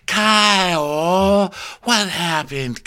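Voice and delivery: high, stretched-out calling; mock concern. Captain coming to check on hurt Kyle, high drawn-out mock concern. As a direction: draw it out with exaggerated concern. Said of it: "She's like".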